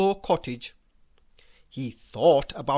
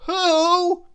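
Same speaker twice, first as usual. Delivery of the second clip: high, loud burst of amazement; astonished character outburst; amazed splutter; 12 semitones higher, 7 dB louder than usual. A narrator's reading. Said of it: "he managed to splutter".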